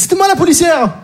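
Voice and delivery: high, eager call; calling out from audience. Acted. Policewoman standing up in the audience, high excited call. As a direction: pipe up high from the crowd. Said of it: "qu'elle se lève et qu'elle dise".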